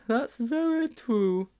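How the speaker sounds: put-on high, very slow boy's voice; slow high-pitched schoolboy; agreeing Sponge.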